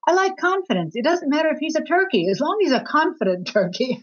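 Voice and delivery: put-on lively, high-pitched quote of a girlfriend; playfully emphatic, high retelling of her answer; quoted girlfriend. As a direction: voice her pitched well up, with conviction. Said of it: "She said".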